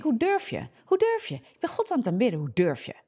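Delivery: indignant outcry. Retold or imitated. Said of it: "Ik denk".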